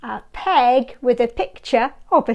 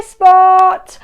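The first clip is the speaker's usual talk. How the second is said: ordinary delivery, nothing marked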